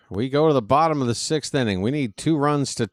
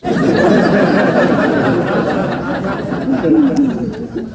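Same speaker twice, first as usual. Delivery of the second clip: loud laughter; 8 semitones higher, 7 dB louder than usual. Real, not acted. Streamer cracks up again.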